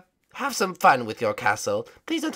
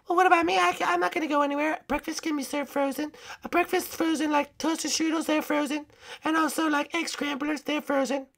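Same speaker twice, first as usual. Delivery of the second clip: high, softer princess voice; high cartoon princess, rambling objection; unbothered princess; 9 semitones higher, 8 dB quieter than usual. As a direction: argue back in a small high voice. Second princess argues she is safe.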